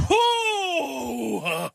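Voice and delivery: long, high "hooo-ha" exhale-cry; big phew after a tense deal; whoop of relief. Real, not acted. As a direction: let out a drawn-out high whoop.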